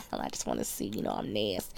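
whispered, sly confession; naughty whisper. Real, not acted. Confessing to listeners she's nasty, in a whisper. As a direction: breathe it out like a secret.

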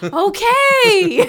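high shouted okay through laughter; loud laughing okay. Retold or imitated. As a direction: yell okay while cracking up. Child answering loud announcement.

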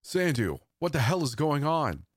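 shouting after him. A narrator's reading. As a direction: yell to someone ahead. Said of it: "My shouts were in vain".

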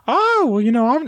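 high-pitched creepy cartoon pervert voice; Herbert from Family Guy. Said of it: "he does his whole voice".

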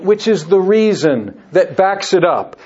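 normal talking voice

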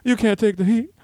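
high funky voice; lightly voiced funk star; [name]. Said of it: "you do a great [name]".

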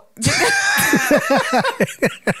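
long shrieking laugh. Real, not acted. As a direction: burst out laughing, high and helpless. Bursts out laughing at the talk.

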